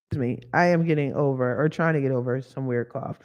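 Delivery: normal talking voice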